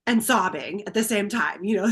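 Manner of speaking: normal talking voice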